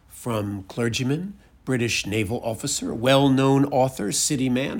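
ordinary delivery, nothing marked

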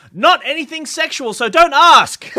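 high, loud insistence; emphatic mock insistence. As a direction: stress "Not anything sexual" high and loud. Reading the ad twice, first emphatic.

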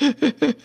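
breathing the laugh in; gasp-laugh on the inbreath; inhaled laugh. Shown as a demonstration. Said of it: "My laugh is in, so I'm like".

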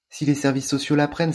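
worried. Acted. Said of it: "s'inquiète Ashley".

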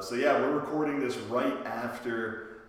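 usual speaking voice

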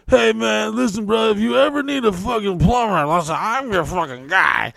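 hammed-up slurring party guy; imitating a drunk bro offering plumbing.

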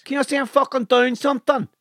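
high-pitched, exasperated northern barman; hammed-up irritation at a waiting customer; mock anger. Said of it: "That's anger".

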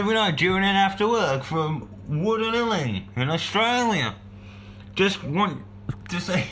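high, hammed-up Australian accent reading the email; mock Australian accent. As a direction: put on a jokey Australian accent, like an Aussie bloke. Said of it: "This isn't Australian, is it?".

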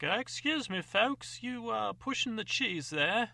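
a doddering stranger politely interrupting; old man. Acted. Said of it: "He's like".

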